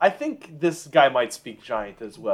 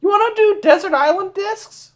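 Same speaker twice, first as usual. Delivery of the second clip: much higher, overly polite voice; polite giant speech; 4 dB louder than usual. Acted. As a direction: play an eager host making small talk. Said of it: "This is in giant".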